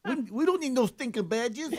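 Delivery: attempted Latin accent. Shown as a demonstration. Host trying the badges line on request, botched Latin accent. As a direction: give the movie line a silly Latin flavour. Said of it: "Give us a little Latin flavor".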